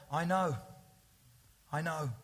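soft and low, a pause before each name; gentle personal reassurance. Acted. Jesus speaking to each member of the congregation, tender and soft.

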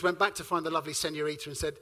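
ordinary talk

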